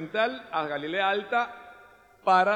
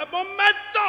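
sudden halt; 12 semitones higher, 4 dB louder, slower than usual. Acted. Jesus stops suddenly.

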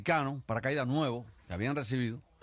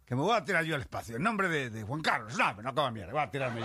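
higher, louder scoffing protest; indignant refusal; 6 semitones higher, 5 dB louder than usual. Acted. Little Spanish soldier scoffs at jumping for the king: indignant protest. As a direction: scoff at the very idea.

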